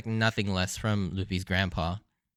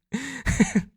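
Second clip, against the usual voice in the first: high laugh; 13 semitones higher than usual. Real, not acted. Laughing after quote.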